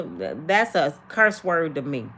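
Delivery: ordinary talk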